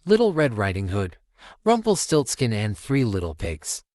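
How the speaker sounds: ordinary delivery, nothing marked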